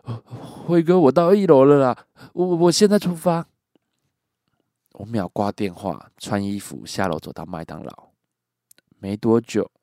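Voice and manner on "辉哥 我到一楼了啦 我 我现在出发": shaken, much higher and louder; retold shaky, panicked report; trembling voice. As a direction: imitate him breathless and scared. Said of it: "他抖着声音说".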